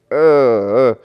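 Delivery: loud drawn-out "uh"; imitated loud groan. Disciplinary coach sees the student arrive, exasperated groan. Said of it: "Coach Hart does this".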